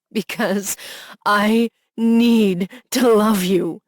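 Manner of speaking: loud, slow, breaking plea; desperate cry. A narrator's reading. Husband sobbing to his wife, loud and slow. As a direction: cry it out to her.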